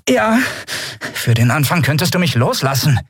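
lightly voiced dark female stranger; dark young woman's voice. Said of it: "dunkel, aber unverkennbar ... einer jungen Frau".

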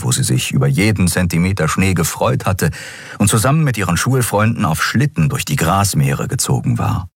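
plain reading voice

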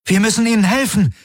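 fast, high-pitched plea; urgent high cry; calling out. A narrator's reading. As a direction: urge them on in alarm. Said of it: "rief Kirai".

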